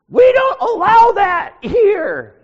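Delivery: put-on very high, loud, slow quavering old man; old pastor voice. Ancient pastor scolding the visiting preacher, high slow old voice. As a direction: forbid it like a creaky ancient pastor. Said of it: "and he says".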